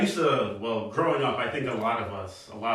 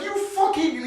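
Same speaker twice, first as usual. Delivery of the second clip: high-pitched mock cabbie outburst; retold sudden rage. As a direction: explode as the imitated angry driver. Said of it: "the zero to sixty was wild".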